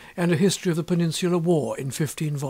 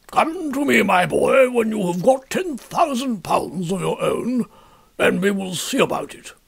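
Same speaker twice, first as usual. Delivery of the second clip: put-on older military father figure, raised and emphatic, unhurried on the middle line; stern old colonel; 4 semitones higher, 4 dB louder than usual. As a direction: lay down the condition like a proud old colonel. Colonel's words in the story.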